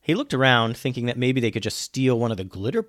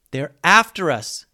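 raised, louder alarm; yelling; 5 semitones higher, 6 dB louder than usual. A narrator's reading. Alex warns as pirates give chase, yelling. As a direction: call out a warning to the crew. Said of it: "yelled Alex".

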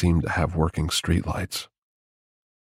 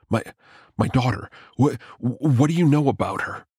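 nervous and breathless; 6 semitones higher than usual. A narrator's reading. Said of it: "Out of breath, no doubt red-faced and slightly nervous".

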